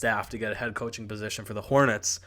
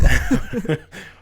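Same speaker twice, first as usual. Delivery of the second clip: breathy high burst of laughter; 12 semitones higher, 10 dB louder than usual. Real, not acted. Laugh after callout.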